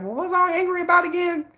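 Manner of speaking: high-pitched mock bewilderment; forgetful puzzlement. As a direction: imitate losing the thread of a grudge. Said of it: "then I'll be over it".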